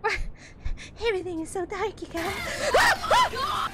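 high, loud yelp rising on guys; real jumpy shout; startled shout. Real, not acted. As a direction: cry out in sudden fright. Startled in dark game level.

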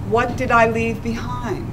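ordinary talk

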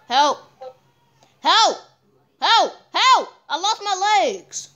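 high, loud calls for help; mock help cries. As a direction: yell help like drowning.

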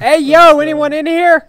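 high, loud call into the room. Acted. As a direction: shout it into the empty building. Calling into an empty building.

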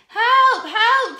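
loud, high-pitched cries of alarm; crying for help. Acted. Stuck carrot calling to anyone for rescue, crying for help. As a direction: call out desperately, each cry falling away. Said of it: "cried Carrot".

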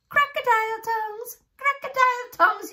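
high, loud excited cry; cried out. Acted. Old man waving his sack at James, high loud cry. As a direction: shriek it out wildly, waving the sack. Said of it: "he cried".

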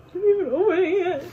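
high, quick, choked up with joy; about to cry from happiness; real happy tears. Real, not acted. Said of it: "I'm literally gonna cry".

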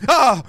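cri court, aigu et fort de frayeur; un « Ah ! » de sursaut; cri d'effroi. Real, not acted. Un screamer lui fait peur. (crie de peur devant un screamer)

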